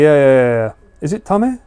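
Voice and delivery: ordinary talk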